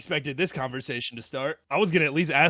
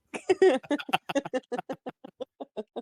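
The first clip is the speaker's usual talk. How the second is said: long, high, loud laughing fit; helpless laughter; 13 semitones higher, 4 dB louder than usual. Real, not acted. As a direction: crack up and keep laughing. Drunk hosts cracking up at confession.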